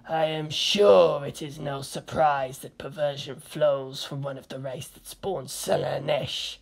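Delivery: slow, otherworldly disdain; slow, cold alien scorn; slow haughty figure. A narrator's reading. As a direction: scold with lofty contempt. Chaos/Farseer figures argue.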